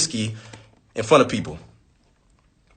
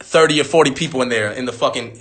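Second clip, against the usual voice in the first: normal talking voice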